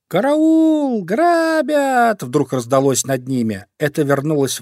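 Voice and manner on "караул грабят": crow crying robbery. Acted. Crow returns, cries robbery.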